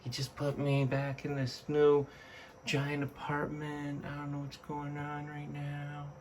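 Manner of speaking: a soft, lightly put-on confused pet voice; voicing the dragon. Owner voicing her dragon's confusion at the new tank, soft and puzzled. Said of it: "He's like".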